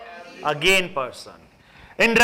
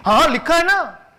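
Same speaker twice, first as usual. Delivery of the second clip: much higher and louder, sure of himself; insistent retort; 10 semitones higher, 5 dB louder than usual. Acted. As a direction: fire it back as if the point is obvious. Officer insisting in court. (ऊँची और ज़ोरदार आवाज़ में ज़िद)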